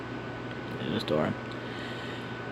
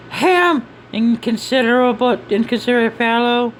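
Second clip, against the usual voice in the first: high-pitched scolding voice; scolding character; 10 semitones higher, 3 dB louder than usual. Acted. Character calling someone an inconsiderate fellow, high scolding voice.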